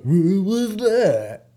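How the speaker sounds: high, very drawn-out bad American accent; wildly elongated mock American drawl; goofy fake American accent. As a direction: mimic an English kid overdoing American, loud and stretched. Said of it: "trying to do my American accent and his really goofy English accent".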